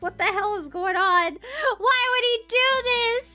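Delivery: high, loud, distraught outcry; rising from alarm to a loud mock wail; acted freak-out. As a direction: wail it in disbelief, hammed-up panic. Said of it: "I'm, like, freaking out".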